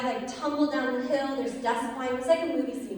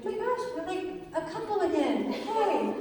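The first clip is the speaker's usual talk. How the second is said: giddy cheer; 8 semitones higher than usual. Retold or imitated. Couple celebrates getting back together.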